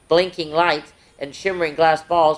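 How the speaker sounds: ordinary delivery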